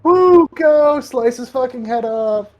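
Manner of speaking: loud, high cheering; cheerleader. Retold or imitated. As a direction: cheer him on wildly. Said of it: "He's, he's acting like a cheerleader here. She's like".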